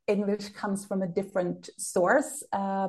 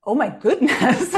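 loud laughing gasp of disbelief; laughing shock; 3 semitones higher, 8 dB louder than usual. Real, not acted. Cohost reacting to the no-cuddling grandfather, laughing loudly.

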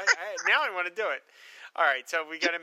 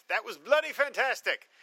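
a hint of a put-on British accent, a touch higher; fake British accent; 6 semitones higher than usual. Reading a listener's email in a fake British accent. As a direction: read the email's line in a lightly faked British accent. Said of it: "Fake British accent".

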